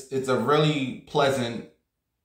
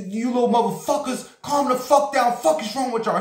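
angry manager yelling; 11 semitones higher than usual. Retold or imitated. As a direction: yell at the rowdy kids to calm down. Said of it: "He was cursing little motherfuckers out".

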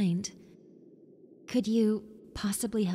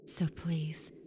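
soft unvoiced please, close to the ear; pleading whisper to the new tenant; 3 semitones lower, 5 dB quieter than usual. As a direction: whisper the request gently. Ghost pleading for help.

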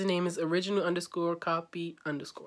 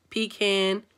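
normal talking voice

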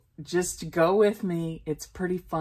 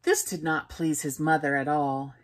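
ordinary delivery, nothing marked

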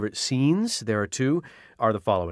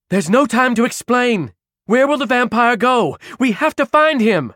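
urgent raised voice; 11 semitones higher, 6 dB louder than usual. Acted. Caro urges Mari.

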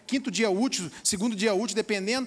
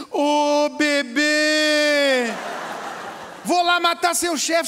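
mock doting wife; much slower than usual. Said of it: "falava assim".

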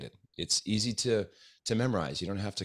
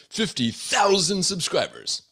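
slow, much higher, very loud hype; monster truck ad; 12 semitones higher, 8 dB louder, slower than usual. Pretend. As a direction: stretch it out like an arena announcer. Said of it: "I have to say that like a monster truck announcer".

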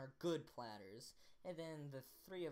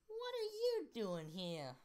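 put-on slow, higher British-accented mother; accidental British accent, drawn out and pitched up; 7 semitones higher, 5 dB louder, slower than usual. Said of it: "why was that, that accent?".

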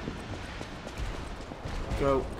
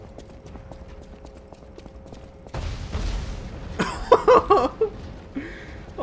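breathless laughter. Real, not acted. After escape.

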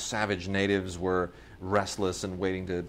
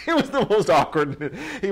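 louder and higher through a laugh; real laugh breaking through; 11 semitones higher, 7 dB louder than usual. Real, not acted. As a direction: crack up telling it. Laughing at the memory.

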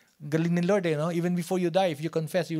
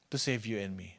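hushed repeat; 9 semitones lower, 10 dB quieter than usual. Acted. Repeats answer even softer.

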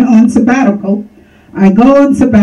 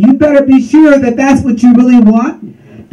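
ordinary talk, nothing marked

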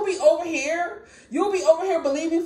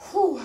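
exhaled whew; 4 semitones higher than usual. Real, not acted. After telling of painful fire.

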